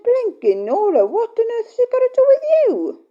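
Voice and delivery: high, loud, exasperated comeback; indignant retort. Retold or imitated. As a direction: snap back at the nosy question. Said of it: "Well, I said".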